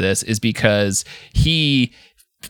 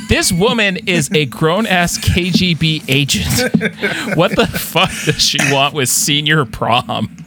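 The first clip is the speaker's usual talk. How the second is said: higher, slow mock-incredulous rant; incredulous disbelief; 6 semitones higher, slower than usual. Co-host mocking the film's senior prom line, incredulous and higher. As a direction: play up the disbelief, drawn out. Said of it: "And I'm like".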